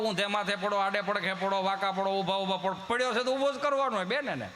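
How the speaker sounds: normal talking voice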